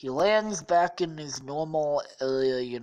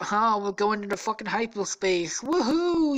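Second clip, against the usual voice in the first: higher, whooping space-adventurer glee; mock-thrilled joyride whoop; excited cheer; 6 semitones higher, 4 dB louder than usual. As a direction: play a pilot thrilled by the jump, ending in a loud high whoop. Acts out hero jumping to hyperspace.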